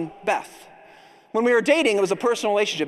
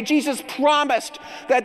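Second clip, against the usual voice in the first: usual speaking voice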